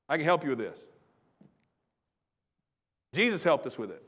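normal talking voice

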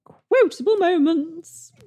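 high, slow sing-song sound effect; jingle voice. Acted. Announcing the quotable moments segment as a sung jingle. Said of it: "created a sodding F X".

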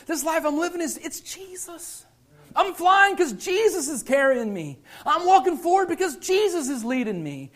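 high, insistent sermon delivery; impassioned preaching. Real, not acted. Preacher crediting Jesus for his life, impassioned. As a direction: preach it fervently in high pitch. Preacher voices Paul with fervour.

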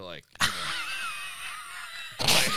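shrieking high fake laugh. Shown as a demonstration.

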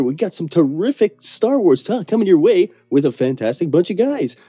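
hammed-up high radio host voice. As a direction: overblown cheesy DJ. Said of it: "gravitas wells all primed".

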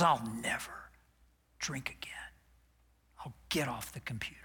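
unvoiced, fast hushed promise; half-hearted whispered vow. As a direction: whisper it, barely committing.

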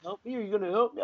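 high and hushed imitated plea; small pleading voice. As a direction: ask for help softly and high, like a scared woman. Frightened woman asks for help.

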